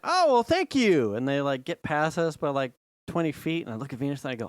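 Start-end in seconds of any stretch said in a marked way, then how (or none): 0.0-1.1 mock polite. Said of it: "And I went".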